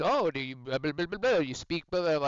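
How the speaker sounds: mock Spanish accent. College instructor quizzing the student, mock Spanish accent. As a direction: imitate the Spanish instructor's accent, half-joking. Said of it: "a very thick Spanish accent".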